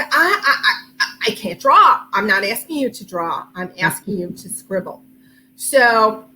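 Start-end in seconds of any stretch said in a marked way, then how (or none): 0.0-2.0 stammering, unsure excuse; hesitant stammer. Retold or imitated. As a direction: fumble the words nervously. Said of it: "too many people say".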